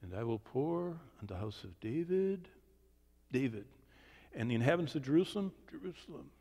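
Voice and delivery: softly acted reading, halting and echoing words; murmured mock read-along, pausing to take it in; passengers reading aloud. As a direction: imitate someone puzzling through a verse. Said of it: "And they go".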